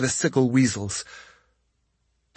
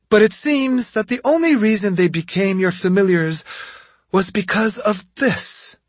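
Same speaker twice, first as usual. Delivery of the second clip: higher, knowing retort; sly girl voice; 9 semitones higher than usual. A narrator's reading. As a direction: tease her with the secret. Yumeko reveals the netsuke.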